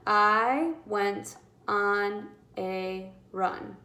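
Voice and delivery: very slow word-by-word "I went on a run"; teacher counting words in a sentence. Shown as a demonstration. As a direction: tap out each word slowly. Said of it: "Five words".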